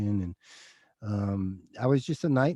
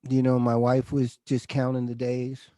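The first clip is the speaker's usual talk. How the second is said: fighting tears; 5 dB louder than usual. Real, not acted. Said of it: "I don't wanna get emotional".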